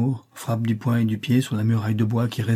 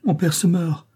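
higher, alarmed cry; 7 semitones higher than usual. A narrator's reading. Juana cries out as her father collapses, alarmed. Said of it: "Juana pousse un cri".

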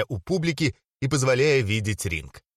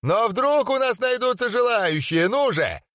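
much higher, yelling; yelling announcer; 10 semitones higher than usual. Acted. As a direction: call out for challengers. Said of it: "завопил Отто Кюхелькен".

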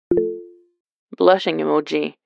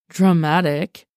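sighed; slower than usual. Acted. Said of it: "Con sighed".

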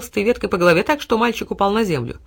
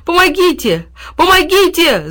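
high, loud scream for help; raised, urgent call for rescue; desperate cry for help; 9 semitones higher, 6 dB louder, slower than usual. A narrator's reading. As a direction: cry out in danger. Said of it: "закричал Тильтиль".

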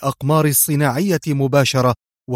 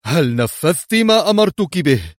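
higher, louder harsh question; sharp demand; 4 semitones higher, 5 dB louder than usual. A narrator's reading. Leader demanding his orders were carried out, harsh. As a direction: demand it fiercely. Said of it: "قبل أن يقول في حدة".

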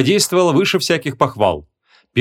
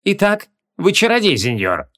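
raised, lightly voiced curious king; 6 semitones higher than usual. A narrator's reading. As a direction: size up the stranger with royal curiosity. King questions the sorcerer.

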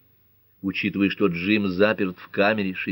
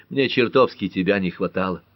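plain reading voice

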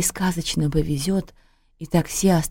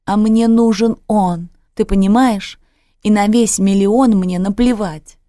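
raised, louder and higher outcry; shouting; 4 semitones higher, 7 dB louder than usual. A narrator's reading. Daughter insisting to her mother she needs him, shouting. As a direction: shout it out in anguished protest. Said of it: "закричала она".

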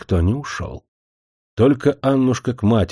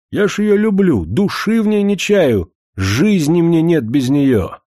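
high, fervent declaration of love; impassioned pleading; 8 semitones higher, 4 dB louder than usual. A narrator's reading. Suitor swearing love to her grandfather, impassioned. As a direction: pour it out, pitch well up.

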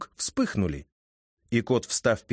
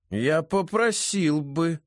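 nasal whine; 6 semitones higher, slower than usual. A narrator's reading. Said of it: "прогнусавил Федя".

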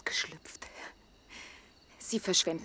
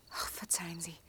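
suddenly soft, whispered sorry; whispered apology; 4 semitones lower, 12 dB quieter than usual. Acted. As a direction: pull back, ashamed. Sudden apology after outburst. (geflüsterte Entschuldigung)

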